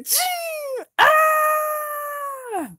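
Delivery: high shrill shout; high squeal. Acted. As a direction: squeal and scream. Sound effect and scream.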